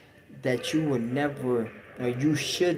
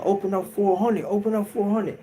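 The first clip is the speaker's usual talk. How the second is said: officer calling; 8 semitones higher, 3 dB louder than usual. Retold or imitated.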